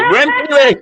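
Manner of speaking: high excited yell through a laugh; shouting at the TV during the game. Retold or imitated. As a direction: cheer him on at the top of your voice.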